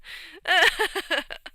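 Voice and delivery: real laugh. Real, not acted. Laughing after the bit.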